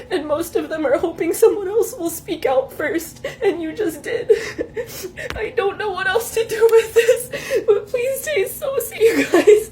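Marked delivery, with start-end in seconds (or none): 4.3-5.3 shaky breathless sob; a sudden teary gasp; crying, worn out. Real, not acted. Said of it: "I can't stop crying". 5.4-7.6 tearful, voice cracking high; crying, worn out. Real, not acted. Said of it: "I can't stop crying". 7.7-9.7 slow, high, tearful plea; crying, worn out. Real, not acted. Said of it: "I can't stop crying".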